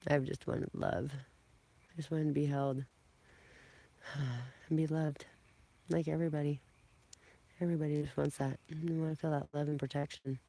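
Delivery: soft, trembling confession; quiet and near tears, slowed down; holding back tears. Real, not acted. Confiding to viewers what she longs for, softly near tears. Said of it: "I'm not gonna start crying".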